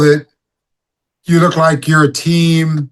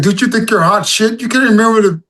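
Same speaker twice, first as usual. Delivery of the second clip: scolding inner voice; 6 semitones higher than usual. Retold or imitated. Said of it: "It's like".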